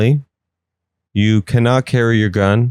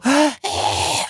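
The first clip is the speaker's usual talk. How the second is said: high, sharp mock gasp; imitated gasp; 5 dB quieter than usual. People breathe poison in the air, mock dying gasp. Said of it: "people breathe, and they go".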